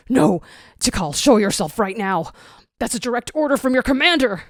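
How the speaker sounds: loud commanding shout, rushing at the end; upset call. A narrator's reading. Zero calling for missing Tikal, upset commanding shout. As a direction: bark it like an order. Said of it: "Upset, he calls out".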